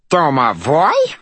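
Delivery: high loud outraged squeak; furious shrill. A narrator's reading. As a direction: shriek the word in outrage. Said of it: "the little fairy shrilled, furious".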